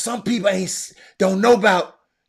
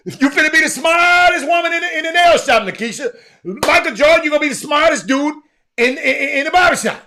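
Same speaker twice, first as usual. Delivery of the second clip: hyped up; 8 semitones higher, 6 dB louder than usual. Real, not acted. Hyping an insane stat.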